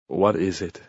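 mildly grumpy question; grumbling. A narrator's reading. Husband woken by pounding on the door, grumbling. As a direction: grumble it, irritated at being woken. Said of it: "he grumbled".

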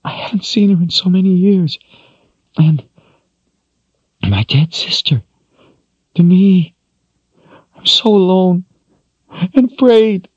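high, crying woman's voice. Acted. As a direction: sob out her loneliness and fear. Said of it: "As she cried".

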